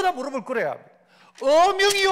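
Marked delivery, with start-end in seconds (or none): 1.4-2.1 eunuch's proclamation. Acted. Eunuch unrolling the decree before magistrates, proclaiming. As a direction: announce the king's order like a court eunuch. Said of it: "하는 순간 다 무릎을".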